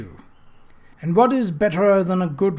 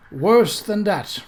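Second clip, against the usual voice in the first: said wryly; 5 semitones higher, 4 dB louder, much slower than usual. Acted. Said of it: "Bradford said wryly".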